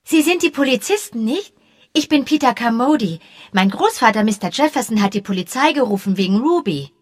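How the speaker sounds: high, eager schoolboy chatter; excited boy. A narrator's reading. Young boy chattering eagerly to the policemen. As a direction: babble on like an excited kid. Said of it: "plapperte aufgeregt drauf los".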